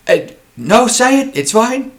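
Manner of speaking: high, loud, overly sweet captain; captain urging the boy to speak, suspiciously nice. Pretend. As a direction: sound nice but suspicious. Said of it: "suspiciously nice".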